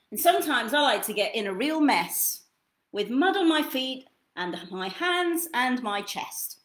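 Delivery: gentle lively storybook read; reading to children. Acted. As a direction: read it like bedtime for a toddler. Reads second book aloud.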